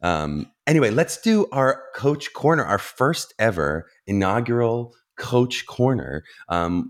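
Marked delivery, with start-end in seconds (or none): 0.7-6.2 announcer. Real, not acted. Announcing podcast segment.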